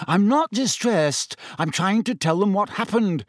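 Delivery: understated high-pitched fury; exasperated boy protesting, voice high; furious. A narrator's reading. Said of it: "Harry said furiously".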